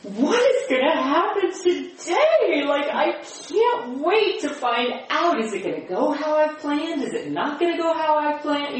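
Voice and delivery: bubbling with eager curiosity; excited. Retold or imitated. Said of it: "I took it from ... to".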